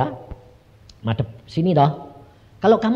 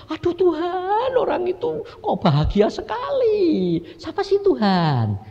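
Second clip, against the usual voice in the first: high, slow, drawn-out marvelling; wistful wonder; slower than usual. Retold or imitated. Seeing a happy person at the palace, high slow wonder. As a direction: stretch it high, marvelling to God.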